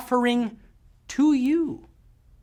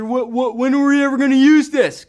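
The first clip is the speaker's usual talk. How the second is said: mock student protest, louder and quicker; complaining student; 4 semitones higher, 5 dB louder than usual. Student challenging the math teacher, mock complaining. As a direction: blurt it like an exasperated pupil. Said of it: "now you can never ask your math teacher".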